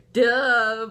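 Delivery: loud, high, drawn-out scoff; mock-obvious duh. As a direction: hit it hard as if it's blindingly obvious. Retells dismissing boyfriend's pregnancy question.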